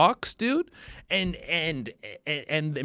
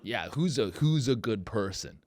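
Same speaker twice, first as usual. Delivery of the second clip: ordinary talk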